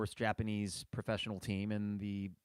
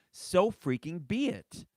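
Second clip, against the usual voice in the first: slow, louder emphatic; emphatic frustration; 5 semitones higher, 5 dB louder, slower than usual. Real, not acted. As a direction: hammer each word home. Fan demanding lineup change.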